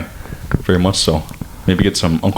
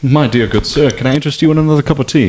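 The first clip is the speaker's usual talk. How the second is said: much higher mock British tea host; a hammed-up British accent, rising high on the sugar; posh British host; 5 semitones higher than usual. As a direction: offer tea like an overdone English gent. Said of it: "British accent".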